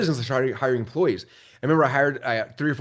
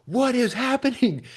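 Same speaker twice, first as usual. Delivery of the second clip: high, slow, drawn-out amazement; stunned disbelief; 12 semitones higher, slower than usual. Retold or imitated. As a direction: let the words hang in sheer astonishment. Sales keep climbing overnight.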